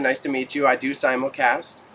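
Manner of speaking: ordinary talk, nothing marked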